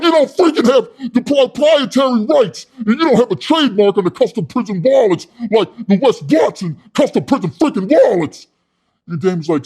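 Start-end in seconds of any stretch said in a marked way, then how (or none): 0.0-8.5 high, loud indignant yelling; hammed-up shouting tirade over stolen rights; imitating a pitchman blowing his top; outraged rant. Pitchman rants at rival idea.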